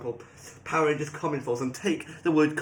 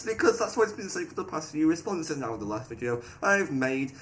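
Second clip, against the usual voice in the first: ordinary talk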